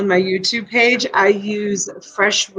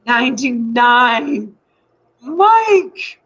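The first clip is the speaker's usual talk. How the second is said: calling out a bid; 3 semitones higher, much slower than usual. Retold or imitated. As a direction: cheer a bid across the room. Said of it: "she just stands there and".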